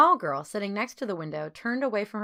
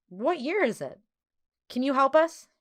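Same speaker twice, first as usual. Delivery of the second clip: sudden urgent burst, a bit louder; blurting; 7 semitones higher, 5 dB louder than usual. A narrator's reading. Bernard suddenly begging the girls for help, blurted out. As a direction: blurt it out before thinking. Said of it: "Bernard blurted".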